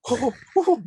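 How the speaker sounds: mock gasping, high-pitched oohs; childlike wonder. Magician showing how some audiences react, childlike wonder. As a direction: imitate an amazed little kid. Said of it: "Some people react like six-year-olds".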